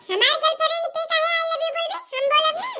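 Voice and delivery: put-on high, childish whining refusal; whiny refusal. Girlfriend sulking to boyfriend. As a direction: sulk and refuse.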